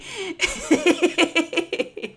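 long high laughing fit; high, drawn-out helpless laughter; helpless giggling fit. Real, not acted. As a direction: lose it again trying to resume. Still laughing, can't resume the recap.

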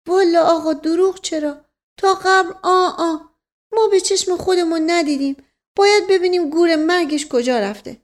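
high, loud comic servant voice; high-pitched rambling servant; protesting servant. Acted. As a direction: protest earnestly, pitched well up. Servant figure protests honesty.

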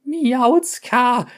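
high loud shriek; shrieked name. A narrator's reading. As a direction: shriek her name in alarm.

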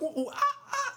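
high-pitched imitation monkey hooting; chimpanzee hoots. Shown as a demonstration. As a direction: hoot like an excited chimp. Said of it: "I was about to make some".

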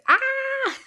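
sudden high excited cry; amped-up yell. Real, not acted. Podcaster showing listeners how amped she was, high excited cry. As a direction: let out a burst of excitement. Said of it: "you heard how amped up I was".

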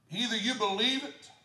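loud, high preaching; whooping sermon cry; full-force preaching. Acted. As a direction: preach it with fire.

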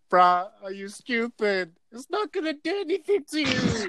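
slow, high-pitched scoffing at a friend; mock peer pressure. Friends pushing a blunt on someone who said no, mock incredulous. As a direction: draw it out high, as if egging someone on.

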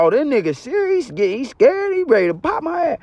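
mock alarmed thought. Inner thought, scared robber.

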